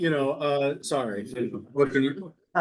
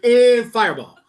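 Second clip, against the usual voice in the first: louder, higher comic toss-off; offhand smiting; 6 semitones higher, 5 dB louder than usual. Retold or imitated. Insulted prophet answering the captain, comically offhand. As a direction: lob it out like a casual spell. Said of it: "why he's like".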